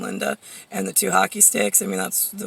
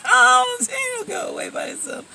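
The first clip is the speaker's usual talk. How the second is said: much higher, breezy mock-innocent; mocking dismissive voice; 13 semitones higher than usual. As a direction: parody people who shrug off lice. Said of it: "No, they're just like".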